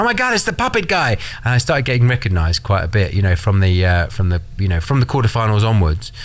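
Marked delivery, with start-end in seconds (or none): 0.0-1.1 excited fan. Retold or imitated. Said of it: "and went".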